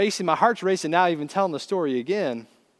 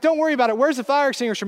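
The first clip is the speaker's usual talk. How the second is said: high, frantic retort; acted panicked shout, pitched up; retold screaming; 8 semitones higher, 4 dB louder, much faster than usual. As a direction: urgently yell past the question. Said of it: "I'm screaming at the top of my lungs".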